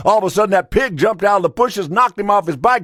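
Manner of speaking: excited farmer. Acted. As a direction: race through it, then stretch the triumphant ending. Farmer recounts pig's dramatic rescue.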